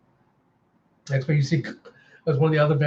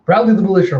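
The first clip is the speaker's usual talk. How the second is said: raised, higher battle order; commanding call; 5 semitones higher, 6 dB louder, faster than usual. Acted. Vix gives battle orders.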